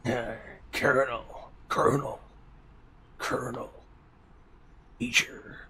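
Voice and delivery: hushed tough soldier voice; gruff whispered soldier impression; Snake voice. Shown as a demonstration. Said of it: "Can I do the snake voice?".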